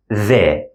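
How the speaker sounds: the with a voiced th as in this. Shown as a demonstration. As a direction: buzz the th. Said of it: "So we have".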